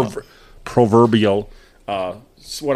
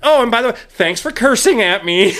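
imitated caller; 7 semitones higher, 3 dB louder than usual. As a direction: toss it in sweetly as the caller, pitch raised. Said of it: "she's like".

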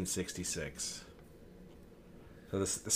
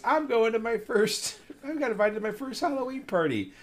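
put-on excited kid voice; 10 semitones higher than usual. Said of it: "when Charlie Brown goes".

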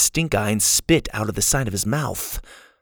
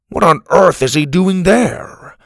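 loud, high, drawn-out astonished question; shocked disbelief; 7 semitones higher, 6 dB louder than usual. Acted. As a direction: demand an explanation in disbelief. Grandpa shocked by the news.

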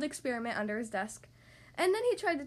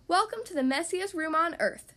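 higher-pitched showy welcome; grand announcement; 5 semitones higher, 4 dB louder than usual. A narrator's reading. Mother rabbit showing animals the room, announcing grandly. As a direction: announce it like a ringmaster. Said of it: "Mother Rabbit announced grandly".

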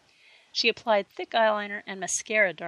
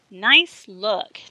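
teasing; 10 dB louder than usual. A narrator's reading. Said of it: "teased Karen".

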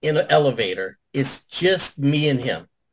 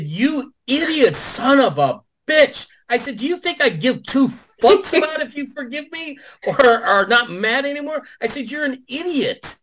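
higher-pitched, exasperated retold tirade; ripping into him; 9 semitones higher than usual. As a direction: tear into him, voice climbing higher. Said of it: "I was ripping his ass".

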